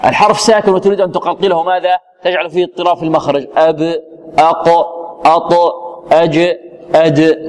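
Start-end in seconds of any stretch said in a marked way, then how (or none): 3.6-7.5 أب أق أط أج أد with a firm echoing bounce; correct qalqala. Shown as a demonstration. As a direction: bounce each stop cleanly, no vowel after. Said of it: "هكذا، وليس". (قلقلة صحيحة بلا ميل إلى حركة)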